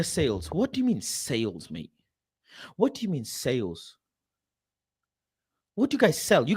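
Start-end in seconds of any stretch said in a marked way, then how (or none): none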